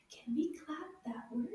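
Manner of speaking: soft whispered prompt; quiet them down with a whisper; whispered classroom cue. Acted. Teacher hushes the class.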